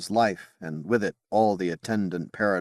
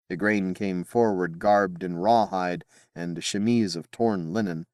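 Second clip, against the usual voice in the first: ordinary delivery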